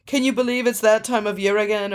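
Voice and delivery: lightly put-on pretentious greeting; mock grand hello, a touch affected. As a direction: imitate a self-important customer making an entrance. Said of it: "here we go with the pretension".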